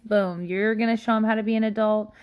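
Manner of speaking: ordinary talk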